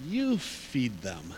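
slow, low and soft; slow low quote. Retold or imitated. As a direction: let the order sink in slowly. Jesus's calm, weighty reply.

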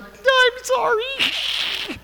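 very high, very slow squeaky I'm sorry; mock schoolgirl squeak saying sorry; squeaky apology. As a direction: squeak out a nervous giggly apology. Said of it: "And I was like".

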